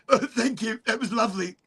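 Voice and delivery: high, laughing British voice; laughing thanks. Retold or imitated. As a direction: giggle through the thanks. Cop after a puff, laughing.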